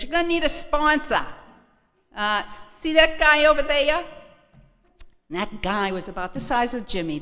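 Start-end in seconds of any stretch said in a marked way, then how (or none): 0.0-4.0 brassy Martha. Retold or imitated. Voicing Martha pointing out sponsor.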